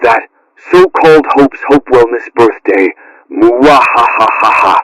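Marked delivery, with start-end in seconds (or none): 3.3-4.8 drawn-out villain cackle; slow theatrical mwahaha; slow evil laugh. Acted. Villain gloats over his plan.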